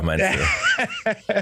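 lachend hoch nachgefragt; laughing. Real, not acted. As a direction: frag es lachend und neckend. Laughing teasing question. (lachende Nachfrage)

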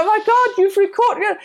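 high shocked gasp of words with a laugh; laughing disbelief. Retold or imitated. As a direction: marvel in outraged amusement.

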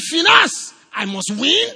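loud, driving preacher's cry; forceful preaching. Acted. Preacher rallying the congregation, loud and forceful.

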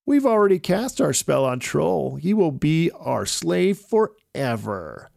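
high witch voice, slowing at the end. A narrator's reading. Witch boasting to Avon about Troll, high and gloating. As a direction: gloat over the captured troll. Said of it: "another witch said".

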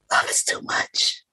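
hushed, breathy whisper of the line; whispered confession. Acted. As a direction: drop to a secret whisper.

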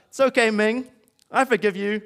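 a playful hammed-up shout across the room; mock yell. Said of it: "he then decides to yell out".

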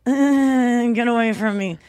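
drawn-out reluctant groan; mock put-off groan; mild repulsion. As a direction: recoil with an "uh", then push them off. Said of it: "be a little bit like".

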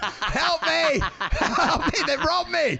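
high panicked plea; mock cry for help. Stolen ATM mascot calling out, high mock panic. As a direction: plead from the back of the car.

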